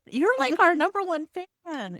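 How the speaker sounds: high-pitched, gushing delight; delighted exclamation. Retold or imitated. Teacher reacting to a student who listens to the podcast, delighted. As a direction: exclaim it as if thrilled by a fan.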